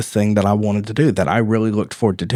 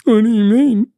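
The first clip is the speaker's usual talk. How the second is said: put-on kid voice; 12 semitones higher, 4 dB louder than usual. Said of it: "And he goes".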